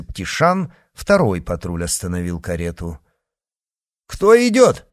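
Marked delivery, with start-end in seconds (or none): 4.1-4.9 high, loud sentry's shout; shouted challenge. A narrator's reading. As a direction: challenge them loudly from a distance. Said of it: "крикнул начальник".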